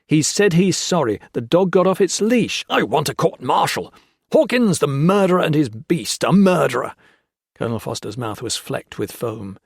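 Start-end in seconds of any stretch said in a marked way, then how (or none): 0.1-2.6 louder, higher, rising anger; officer angrily defending Hawkins; angry. A narrator's reading. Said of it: "Gray said angrily". 2.7-6.9 loud, higher, frantic accusation; raving. A narrator's reading. Foster raving at Hawkins.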